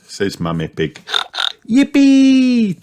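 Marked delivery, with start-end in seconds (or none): 1.7-2.8 high, loud, drawn-out cheer; excited cheer. A narrator's reading. Little pig hearing friends will visit, excited cheer. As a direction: cheer like a delighted child. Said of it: "cheers Peppa".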